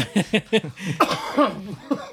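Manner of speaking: a short real laugh, rising; burst of laughter. Real, not acted. Laughing at the list of his Netflix categories, genuine laugh. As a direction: laugh out loud at your own words.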